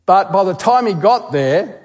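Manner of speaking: ordinary talk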